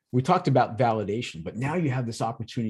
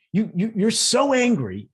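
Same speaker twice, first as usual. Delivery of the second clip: slow, louder, higher blunt accusation; accusing tone; 10 semitones higher, 6 dB louder than usual. Shown as a demonstration. Coach showing the wrong thing to say to a teen, slow and loud. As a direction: spell out their anger the wrong way. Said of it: "instead of saying".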